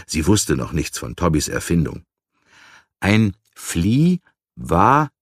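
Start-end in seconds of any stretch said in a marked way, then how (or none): 3.0-5.1 slow, syllable by syllable; drawn-out, separated syllables, as if spelling it; spelled out slowly. A narrator's reading. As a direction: sound out the word with gaps between syllables. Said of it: "buchstabierte Tobby langsam".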